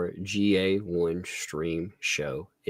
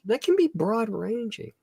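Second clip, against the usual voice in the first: much higher, prim formal read; mocking formal voice; 12 semitones higher than usual. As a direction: read it like a pompous invitation. Reading the recruiter's offer.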